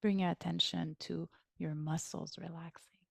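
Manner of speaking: soft whispered meditation guidance; guided meditation. Acted. Guiding listeners to relax their muscles, whispering. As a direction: whisper the cue gently to the body.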